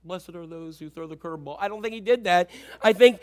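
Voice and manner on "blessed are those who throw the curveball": hushed, lower mock-holy voice; mellow movie Jesus. Preacher mocking a false image of Jesus, mellow hush. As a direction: murmur it with mock serenity. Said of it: "walked around and said".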